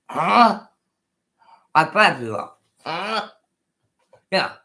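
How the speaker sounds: ordinary delivery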